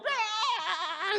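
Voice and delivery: sudden high yelp as the herd bolts; a loud mock cry of spooked sheep; startled herd cry. Preacher mimics the spooked herd crying out.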